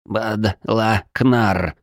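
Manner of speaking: slow, plaintive gurgle; drawn-out pitiful creature gurgle; gurgling alien voice. Acted. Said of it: "жалобно пробулькало существо".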